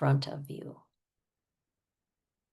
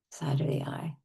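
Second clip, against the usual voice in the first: ordinary delivery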